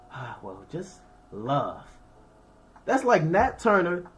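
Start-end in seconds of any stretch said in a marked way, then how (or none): 0.1-1.8 soft, low, much quieter serene monk; mellow monk. Retold or imitated. Ancient monk advising a warrior, soft and serene. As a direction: float it out like a monk who meditates all day. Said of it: "He gonna tell me".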